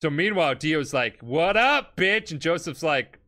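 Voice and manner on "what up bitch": taunting. Retold or imitated. Dio taunting Joseph.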